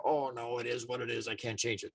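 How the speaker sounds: mock resignation. Someone abdicating the power to change, mildly resigned. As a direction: give up quietly, as if helpless.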